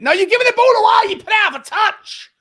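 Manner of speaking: high, loud, fast yell of frustration; exasperated shout. Real, not acted. Fan watching the ball given away, exasperated shout. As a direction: burst out shouting at the players. Scolds players live.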